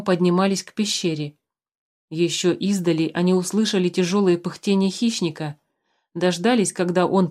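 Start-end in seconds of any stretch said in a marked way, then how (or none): none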